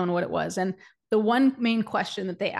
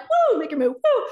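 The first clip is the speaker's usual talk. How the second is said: much higher whoop, louder; hyped cheer; 10 semitones higher, 5 dB louder than usual. Retold or imitated. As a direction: cheer it like at a rally.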